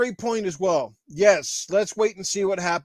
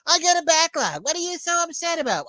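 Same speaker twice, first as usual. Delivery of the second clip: much higher mocking excuse voice; mock whiny gamer; 8 semitones higher than usual. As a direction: parody a gamer brushing off complaints, pitched up.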